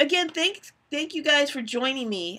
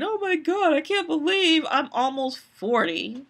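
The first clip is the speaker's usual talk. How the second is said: higher, whiny moan about turning forty; whiny complaint; 5 semitones higher than usual.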